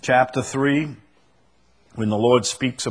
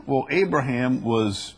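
ordinary delivery

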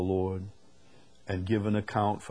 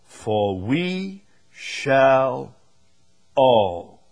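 "all" set apart and loud; stress on "all"; 3 semitones higher, 6 dB louder, much slower than usual. Shown as a demonstration. Preacher shows the verse's emphatic word, heavy stress on "all". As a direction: draw out the lead-in slowly, pause, then hit "all" hard. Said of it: "very, very emphatic".

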